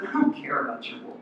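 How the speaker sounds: soft, low dismissal of the boast; quiet dismissal. Retold or imitated. Jesus dismissing their pride.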